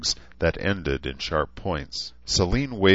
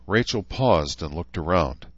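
usual speaking voice

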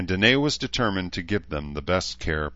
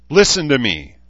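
higher, louder angry snarl; 7 semitones higher, 9 dB louder than usual. A narrator's reading. Shida snarls at Edmund to listen, angry. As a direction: snap at him to listen. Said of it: "the projection snarled".

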